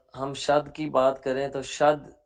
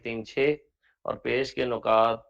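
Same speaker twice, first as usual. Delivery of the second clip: ordinary talk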